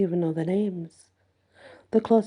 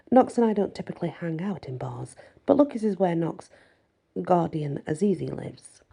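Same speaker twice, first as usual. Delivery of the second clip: plain reading voice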